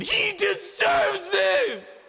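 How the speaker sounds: very high, loud, slow bellow; furious bellowed verdict. Acted. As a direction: roar the verdict. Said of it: "she bellowed".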